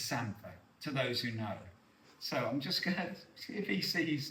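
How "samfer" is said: "samphire" said as SAM-fer; local pronunciation. Shown as a demonstration. Correcting the Yorkshire chef, SAM-fer pronunciation. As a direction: end "samphire" on a weak -fer. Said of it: "and it's not, it's samphire".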